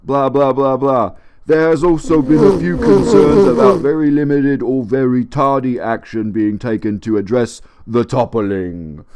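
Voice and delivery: pompous British official reading; official letter voice. Retold or imitated. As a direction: read it like a stuffy bureaucrat.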